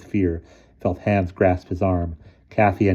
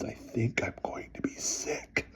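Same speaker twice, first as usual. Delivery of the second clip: voiceless, slow whisper; boy who has lost his voice, whispering slowly; mouthed whisper; 5 semitones higher, 12 dB quieter than usual. A narrator's reading. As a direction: barely mouth the words. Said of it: "Elmer mouthed the words".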